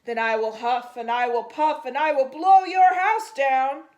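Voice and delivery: booming loud roar; the shark roars his threat at the pigs. A narrator's reading. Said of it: "so he roared".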